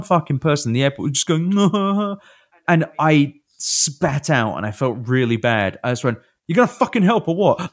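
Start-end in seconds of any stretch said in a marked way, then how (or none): 1.6-2.1 mock giggle, pitched up; imitated giggle. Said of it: "like a giggle". 6.5-7.7 quick, higher-pitched irritated snap; retold angry outburst. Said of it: "I was just so angry".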